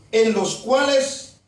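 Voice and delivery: slow scripture reading. Real, not acted. Preacher reads Ephesians to the congregation, slowly. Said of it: "se lo voy a leer únicamente despacio".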